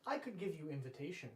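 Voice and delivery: ordinary delivery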